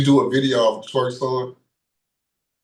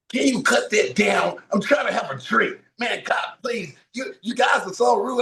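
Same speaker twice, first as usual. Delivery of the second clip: higher, mock-angry yell at the bar; imitating angry shout; 7 semitones higher than usual. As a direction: yell at him like the irritated bar guest. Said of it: "he got so upset".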